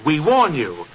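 slow, deliberate dictation; dictating slowly. Acted. Holmes dictating the letter to Gardner, slow and deliberate. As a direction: spell out each word for the scribe. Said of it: "I want you to write this letter".

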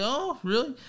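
"Oh, really?" pitched high and rising like real interest; interested question. Shown as a demonstration. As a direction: make "really" sound curious, not sarcastic. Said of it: "change your inflection on it".

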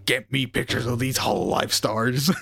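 slow, barking newspaper boss; a hint of a gruff editor; J. Jonah Jameson. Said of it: "Jonah Jameson".

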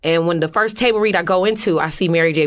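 ordinary talk

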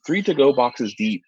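usual speaking voice

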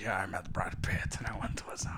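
low, soft mimicry of someone talking; mocking imitation. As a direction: imitate him in a hushed low voice. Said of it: "You sound like".

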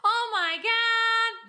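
high, loud wail of complaint; hammed-up mom griping, stretching the last words; imitating mom. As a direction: wail it like an exasperated mother. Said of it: "That's how she sounds".

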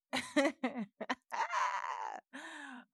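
high laughing shriek. Real, not acted. Laughs before answering.

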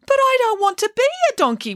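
high, fast wail; high-pitched childish wail. A narrator's reading. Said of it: "wailed Bramwellio".

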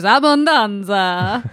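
put-on slow, drawn-out Italian accent. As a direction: stretch it like an overdone Italian. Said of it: "she doesn't sound Italian enough".

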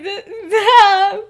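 stammered, loud, laughing yeah; mock awkward agreement. Friends pretend it's a fun joke, hammed-up agreement. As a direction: play along uneasily, drawn out.

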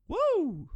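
high, loud "woo"; hammed-up celebratory whoop; party whoop. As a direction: whoop like a drunk big shot. Drunk bravado, party whoop.

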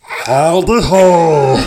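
slow, stretched-out greeting; lightly voiced cartoon character call, slowly; Mister Hanky. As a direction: draw out the catchphrase long. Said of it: "a little bit more of the Mister Hanky".

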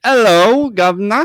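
high, loud joke Cockney greeting; mock British accent. Teasing a friend's first words here, mock British accent. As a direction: belt out a hammed-up British accent. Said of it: "You're like".

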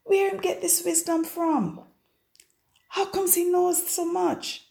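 high-pitched mock disbelief; incredulous townspeople. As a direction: mimic doubting locals, pitched right up. Voices astonished townspeople in dialect.

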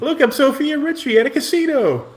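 high-pitched mock celebrity voice; celebrity cameo parody. Co-host spoofing her playing an extra, high mock voice. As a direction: put on a high, hammed-up cameo voice.

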